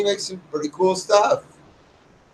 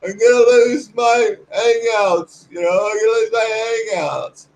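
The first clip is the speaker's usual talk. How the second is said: mock whining; 4 semitones higher than usual. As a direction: drag out a whiny complaint, higher. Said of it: "They're all crying and whining".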